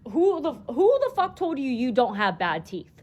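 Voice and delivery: loud, incredulous demand; indignant outburst. Real, not acted. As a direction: let the outrage burst out on the question. Outraged rant about his teeth.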